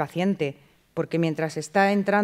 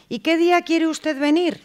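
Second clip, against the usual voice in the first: high, louder mock receptionist asking the patient; receptionist's open question; 9 semitones higher, 5 dB louder than usual. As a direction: play the careless receptionist on the phone. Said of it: "a un paciente por teléfono le decimos".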